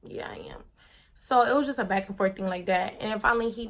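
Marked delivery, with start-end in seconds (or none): 0.0-0.6 whispered, breathy defiance; whispered reply. Retold or imitated. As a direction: breathe it out under your breath. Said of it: "And I was like".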